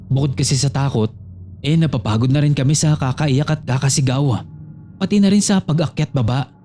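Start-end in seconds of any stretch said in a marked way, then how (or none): none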